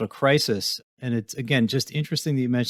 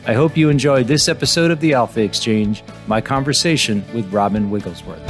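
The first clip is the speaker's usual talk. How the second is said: louder, scripted host introduction over music; projected voice-over for an episode preview; podcast intro read; 4 dB louder than usual. Real, not acted. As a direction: read the episode intro with firm, measured emphasis. Pre-recorded podcast introduction.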